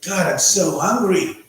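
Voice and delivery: usual speaking voice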